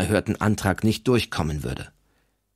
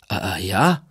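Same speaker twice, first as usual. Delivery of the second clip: half baffled. A narrator's reading. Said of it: "halb verdattert, halb amüsiert".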